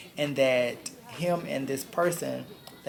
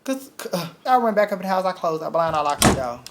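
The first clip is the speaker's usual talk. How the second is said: panicked retelling; 6 semitones higher, 7 dB louder than usual. Real, not acted. Fleeing after his threat.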